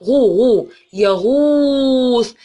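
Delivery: يغوص stretched very slowly, long vowel held; teacher holding the long vowel in يغوص; slow sounding out. Shown as a demonstration. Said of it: "نشوف اللي بعدها كده مد بالواو".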